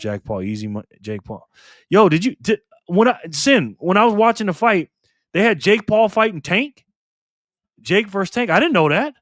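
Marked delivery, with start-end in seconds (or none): 1.9-9.1 excited disbelief. Real, not acted. Surprised at the fight card.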